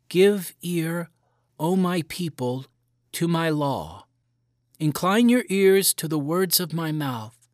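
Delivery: solemn little recital of scripture; five-year-old reading the Bible to his mother. A narrator's reading. Boy reads psalm aloud.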